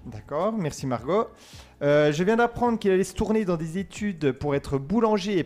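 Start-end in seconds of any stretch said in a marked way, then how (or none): none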